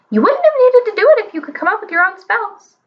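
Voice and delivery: high mocking bird voice; cheeky parrot voice. A narrator's reading. Parrot taunts.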